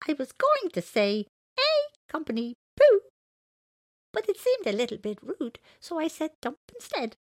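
very high, loud creature voice; little elephant character. Acted. Elephant explains the joke to JC, high creature voice. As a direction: pipe it high as a little animal.

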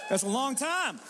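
ordinary talk, nothing marked

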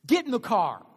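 loud barked order with a laugh; mock cop order. Cop ordering the driver into his car, loud laughing bark. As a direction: snap it like a fed-up cop.

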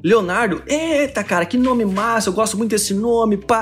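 lightly voiced chummy carioca; a hint of a laid-back Rio guy; carioca hustler voice. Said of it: "tipo aqueles malandro- ... carioca". (malandro carioca, levemente imitado)

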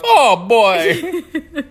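high cartoon yelp; hammed-up game plumber taking a hit; Mario. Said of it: "he's getting hit by shells".

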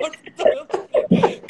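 slow, loud laughing speech. Real, not acted. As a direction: laugh while speaking. Laughing after the quote.

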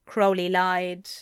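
plain reading voice

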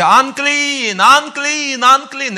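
high, loud drawn-out cry; leper calling out. Acted. Lepers warning everyone as they walk, calling out. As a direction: call it out far, stretching each word. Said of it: "they have to say".

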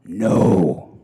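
a gruff, growled no; low growl. Retold or imitated. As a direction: growl it like a warning. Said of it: "voice dropped an octave and turned into a growl".

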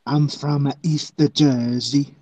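slow, put-on East Jersey accent; mock East Jersey accent. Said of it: "This is the East Jersey accent".

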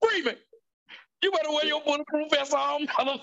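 high, hammed-up threatening film line; movie threat. Threatening the police in a movie, hammed-up menace. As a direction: quote the film villain's threat, pitch way up. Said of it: "one of the funniest lines in cinema history".

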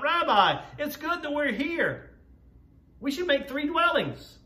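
high-pitched eager blurt. Acted. Peter blurting to Jesus at the sight, eager high blurt. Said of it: "he blurts out".

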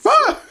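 high yelped scream; a cartoonish game scream; mock scream emote. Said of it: "hear the scream emote".

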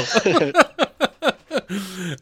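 trailing laugh; laughter. Real, not acted. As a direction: let the laugh run on. Host keeps laughing.

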